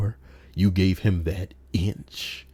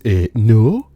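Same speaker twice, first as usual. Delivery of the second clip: higher, louder flat "eh, no"; a mock stubborn stranger; stubborn refusal; 5 semitones higher, 8 dB louder than usual. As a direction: intruder shrugging off being told to leave. Man refusing to leave.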